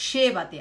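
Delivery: "llévate" stressed at the start, LLÉ-va-te; stress on first syllable. Shown as a demonstration. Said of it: "Se ele fosse a pessoa tu".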